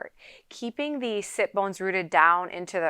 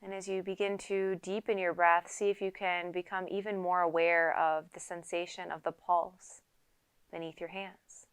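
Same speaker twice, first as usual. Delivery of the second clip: softer, even meditation voice with long pauses; unhurried yoga teacher leading a quiet meditation; guided meditation; 5 dB quieter than usual. Acted. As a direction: calmly guide them into stillness, leaving space to breathe. Leads a seated guided meditation.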